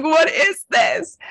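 high, drawn-out mock wail; retold bewilderment. Guest recalling her first retreat, retold bewilderment. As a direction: cry it out high and slow, hammed-up. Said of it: "and I was like".

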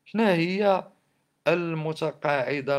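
loud. Real, not acted. Indignant rant about the state.

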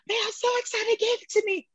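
high, gushing delight; excited. Retold or imitated. Lifter retelling her joy at being given the lift, excited and high. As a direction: bubble over with good news.

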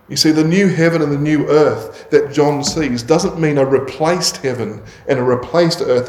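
ordinary talk

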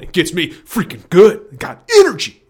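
sudden loud jump up in pitch; hyped exclamation; excited burst. Real, not acted. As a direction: let the energy burst out. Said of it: "It gets me going".

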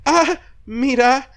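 high, slow, drawn-out delighted "Ah, mira"; fake-charmed delight; mock delighted ah. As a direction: act pleasantly surprised, stretching it out. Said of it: "Y yo dije".

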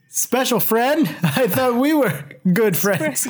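high-pitched feigned offense; mock hurt. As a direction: play the jilted friend for laughs. Playing hurt over "special friend.

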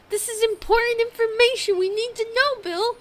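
loud, high, mock outrage; mock indignant. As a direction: demand it with fake importance. Mock-serious demand about calories.

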